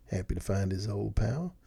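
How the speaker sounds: plain reading voice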